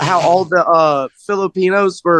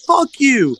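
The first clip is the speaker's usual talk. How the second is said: high, half-voiced childish scream; retold kid's scream; 7 semitones higher than usual. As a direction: imitate a kid yelling, held back. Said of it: "he screamed".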